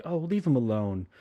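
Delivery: lightly voiced, tired brush-off; mild annoyance. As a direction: say it as a weary, mild plea. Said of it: "Sean's just like".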